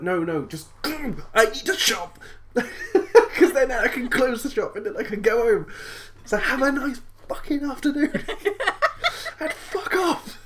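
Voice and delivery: high, laughing fake-polite send-off; chirpy customer service that sours, through laughs; mock polite rant. Shopkeeper venting at a late customer, giggling mock-polite rant.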